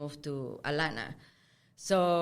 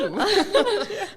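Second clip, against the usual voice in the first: loud high cackle; high laugh; 14 semitones higher, 9 dB louder than usual. Real, not acted. Guest cracking up at her mom's guesses, loud laughter. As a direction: burst out laughing.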